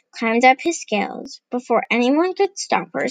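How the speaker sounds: ordinary delivery, nothing marked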